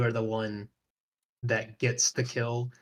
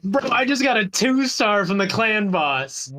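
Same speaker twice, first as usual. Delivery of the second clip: loud, high disbelieving yell; excited shout; 7 semitones higher, 3 dB louder than usual. Retold or imitated. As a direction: shout it like an all caps message. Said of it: "all caps in the messenger app".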